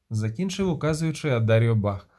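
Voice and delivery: ordinary delivery, nothing marked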